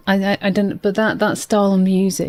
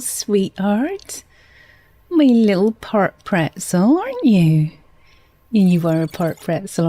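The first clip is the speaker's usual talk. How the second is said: playful put-on West Country lilt; West Country accent. Streamer fussing over Retzel the pretzel, West Country accent. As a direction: coo at them in a broad West Country accent. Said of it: "stop talking in a West Country accent".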